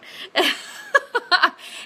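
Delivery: breathy laugh at her own slip; high airy chuckle at the fumble; sheepish laugh. Real, not acted. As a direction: laugh off dropping the phone. Drops the phone mid-sentence.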